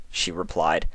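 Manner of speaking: plain reading voice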